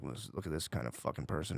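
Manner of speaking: low, soft private judgement; quiet, disdainful thought to yourself; judging in his head. Retold or imitated. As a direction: mutter a silent judgement under your breath. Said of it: "just judge him in my head".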